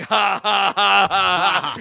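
slow, low fake laugh; mock laughter. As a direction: laugh deliberately as a cartoon Italian bot. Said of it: "They also go like".

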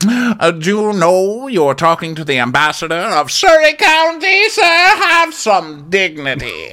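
haughty high-pitched grande dame; hammed-up theatrical indignation; Karen. Acted haughty ambassador of Surrey County.

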